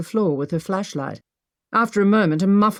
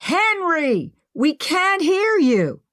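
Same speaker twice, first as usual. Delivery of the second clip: shouting back; 11 semitones higher, slower than usual. Acted. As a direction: yell each word up through stone. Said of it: "Maggie shouted back".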